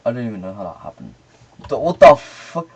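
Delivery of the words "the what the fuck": loud, higher startled outburst; shocked yell. Real, not acted. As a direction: yell in disbelief. Shocked by game event.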